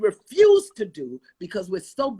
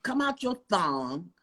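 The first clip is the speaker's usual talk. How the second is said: usual speaking voice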